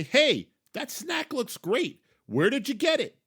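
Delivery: loud, high-pitched, eager call-out; calling to a stranger. Acted. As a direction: holler it excitedly at a passing stranger. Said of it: "go up to a complete stranger and say".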